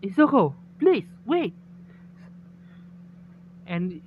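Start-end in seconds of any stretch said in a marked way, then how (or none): 0.0-1.5 high, louder, urgent cry; screaming plea. A narrator's reading. Kendo calls to unconscious Izuku, pleading in a raised voice. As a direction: cry out the name in a mild narrated scream. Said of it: "Kendo screaming".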